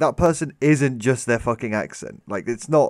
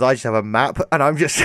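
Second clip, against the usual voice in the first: usual speaking voice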